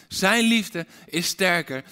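ordinary talk, nothing marked

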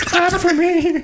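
slow squeaky plea while laughing; high laughing plea. Pretend. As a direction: stretch it high, giggling.

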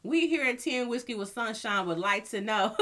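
normal talking voice

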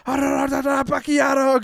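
high, drawn-out babbled holler; imitated garbled yell. Host imitating a wrestler parroting words he doesn't know, babbled yell.

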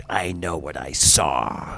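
low, slow and certain; mock stern lady. Quoting the grocery store lady, low and deliberate. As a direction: mimic her cold certainty, low and slow. Lady's curt reply quoted.

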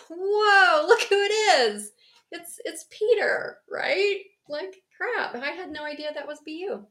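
high, loud surprised crowd; opening the door to a supposed dead man, astonished; mock astonished. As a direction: gasp it out in disbelief.

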